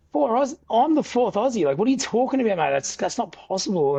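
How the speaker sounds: high incredulous outburst; can't believe what he hears; stunned retold protest, very high; shocked disbelief.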